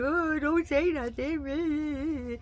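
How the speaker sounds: high, very slow mocking whine. Mimicking a politician begging for silence, high and slow. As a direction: stretch it out like a pleading creep. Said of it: "Same thing".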